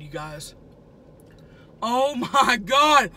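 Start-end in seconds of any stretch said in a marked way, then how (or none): 1.8-3.1 slow, loud moan of pleasure; drawn-out delight. Real, not acted. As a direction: stretch it out, savoring. Savoring food, stretched.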